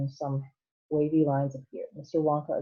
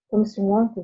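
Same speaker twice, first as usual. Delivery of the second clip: loud, higher eager shout at Mister Wonka; excited shout; 6 semitones higher, 6 dB louder, faster than usual. Acted. Boy shouting a question at the chocolate maker, eager loud. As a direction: call out to get his attention. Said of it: "he shouted".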